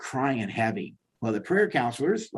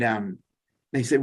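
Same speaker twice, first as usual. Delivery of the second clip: normal talking voice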